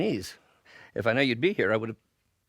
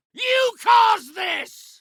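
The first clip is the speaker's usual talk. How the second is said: enraged shouting; 5 dB louder than usual. Acted. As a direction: scream the blame at your opponent. Villain blames opponent in fight.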